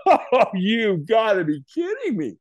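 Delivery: high, drawn-out incredulity; mock disbelief. Brain talking back while staring at glowing coals, incredulous. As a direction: stretch it out in comic disbelief.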